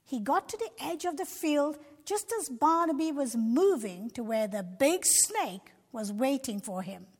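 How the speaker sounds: ordinary delivery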